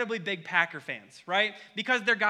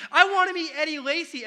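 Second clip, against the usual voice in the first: put-on higher and louder child's yell; toddler screaming; 7 semitones higher, 8 dB louder than usual. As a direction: demand it like a thrilled little kid. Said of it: "starts screaming".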